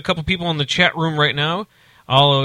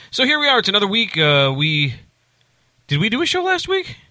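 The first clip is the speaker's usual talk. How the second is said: pitched way up, playful; high silly voice; 7 semitones higher than usual. Acted. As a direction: squeak it out in a high goofy voice. High silly voice after recap.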